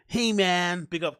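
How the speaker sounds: light mock American accent. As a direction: toss off a mock American greeting. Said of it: "American accent".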